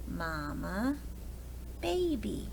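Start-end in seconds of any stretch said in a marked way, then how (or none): none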